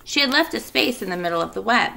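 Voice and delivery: plain reading voice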